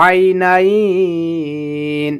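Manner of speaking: আইনাইন drawn out for three alifs; long madd. Shown as a demonstration. Teacher stretching আইনাইন for students. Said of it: "অতএব এখানে তিন আলিফ টেনে পড়তে হবে".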